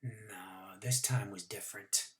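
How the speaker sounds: low suspenseful whisper; hushed whisper. A narrator's reading. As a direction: lean in and whisper the secret.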